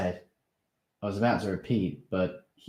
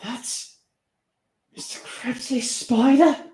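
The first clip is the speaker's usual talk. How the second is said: shocked; 8 dB louder, slower than usual. Acted. Steve shocked.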